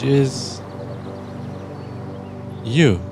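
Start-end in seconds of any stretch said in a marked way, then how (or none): none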